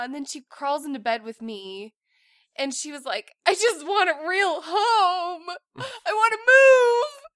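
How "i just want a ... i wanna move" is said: slow, high, loud sobbing imitation; mock crying child. Mom imitating crying daughter, slow, high and loud. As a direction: whimper it out like a tearful kid. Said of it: "so she's bawling".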